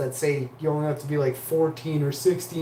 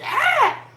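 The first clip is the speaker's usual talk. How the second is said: retold scream; 4 dB louder than usual. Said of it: "And I was like".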